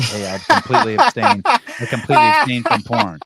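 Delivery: high, loud mock voice; mock quote. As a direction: put on a high voice for a claim you deny. Acts out a claim he denies making.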